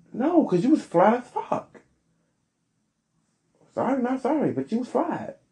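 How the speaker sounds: high, sassy put-down; sassy verdict. Real, not acted. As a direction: read her, high and cutting. Scathing verdict.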